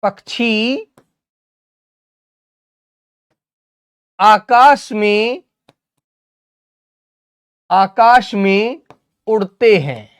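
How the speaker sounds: drawn-out phrases, rising before each long pause; slow dictation. Shown as a demonstration. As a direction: dictate slowly, repeating as pupils write. Dictates sentence slowly while writing.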